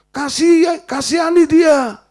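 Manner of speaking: preacher booming the Spirit's word to the congregation, shouted; shouted command. Retold or imitated. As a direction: cry out the command to show mercy.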